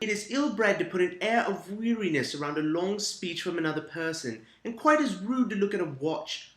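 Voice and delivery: mock-fancy British reading; shitty British accent. Host fancying up old etiquette excerpts in a shitty British accent. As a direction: read it out in a badly faked British accent. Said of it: "my very shitty British accent".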